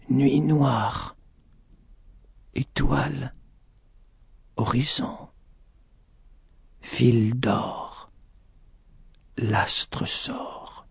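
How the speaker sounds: faint, disjointed murmur; moaning strange words. A narrator's reading. Injured lady uttering strange words, faint moaning. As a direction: let each word drift out alone, fading to whisper. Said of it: "celle-ci gémissait et prononçait d'étranges paroles".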